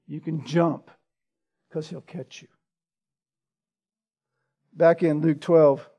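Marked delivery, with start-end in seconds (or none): 0.1-2.5 tender reassurance. Real, not acted.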